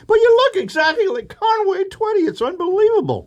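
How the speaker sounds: very high, breathless fan voice; amazed young lady. Retold or imitated. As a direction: gush like you can't believe your eyes. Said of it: "young lady answers the door".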